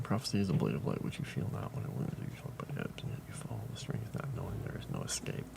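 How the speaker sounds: soft, whispery reading of an ominous prophecy; hushed storyteller whisper, trailing to nothing; hushed dramatic reading. Acted. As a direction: breathe the prophecy out like a secret. Reads a prophecy quote aloud.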